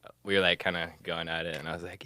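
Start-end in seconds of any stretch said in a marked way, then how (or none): none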